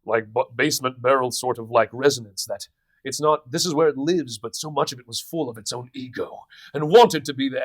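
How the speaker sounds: a hint of a big braggart's chest voice; big man voice. Shown as a demonstration. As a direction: fill the room with an ego-driven chest voice. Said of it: "I did this".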